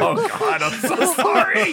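very high-pitched, squealing apology; squealed apology. Real, not acted. Game master to panicking player, squealed apology.

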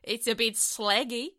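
mock English accent, rising at the end; overdone English accent; American faking English. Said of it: "Americans try and sound English".

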